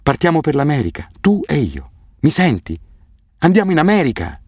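high, elated exclamations; excited calling. A narrator's reading. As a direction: call joyfully through a closed door. Calling joyful news through the door.

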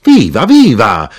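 loud, drawn-out high cheers; shouting. A narrator's reading. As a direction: cheer it loudly, stretching each word. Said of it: "l'arrotino gridò".

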